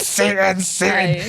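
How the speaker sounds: overplayed chant of accusation; mock condemnation. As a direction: mimic an outraged accuser naming the devil. Wife's condemnation in retold scene.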